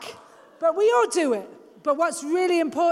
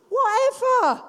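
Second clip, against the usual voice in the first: dismissive; 6 semitones higher, 3 dB louder than usual. Acted. Brushing off gossip, acted.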